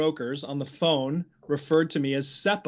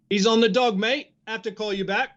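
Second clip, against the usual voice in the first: cockney broker; 5 semitones higher, 3 dB louder, faster than usual. Retold or imitated. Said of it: "repeating the buddy's line".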